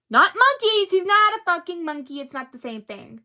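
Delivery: loud, high indignant outburst. Acted. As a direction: yell the correction, outraged. Indignant correction about chimps.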